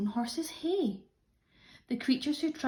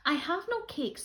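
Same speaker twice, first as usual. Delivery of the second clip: rabbit's reply; 7 semitones higher than usual. Acted. Rabbit answers the rat.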